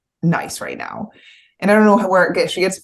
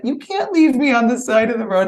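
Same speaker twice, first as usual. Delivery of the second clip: high-pitched imitation of a worried child; upset young son; 9 semitones higher, 3 dB louder than usual. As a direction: plead like a little boy to his mom. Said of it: "my son was like".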